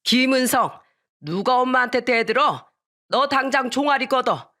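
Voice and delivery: angry elder's telling-off; scolding grandfather. A narrator's reading. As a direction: order the child sternly. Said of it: "할아버진 저한테 회초리를 드셨어요".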